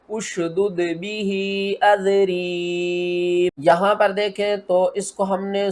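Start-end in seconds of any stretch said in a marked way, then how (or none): 0.1-3.5 slow tajweed chant, long held vowels; Quran recitation demo. Shown as a demonstration. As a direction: recite slowly, stretching the endings. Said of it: "तो किस तरह पढ़ेंगे". (ठहर-ठहर कर तिलावत)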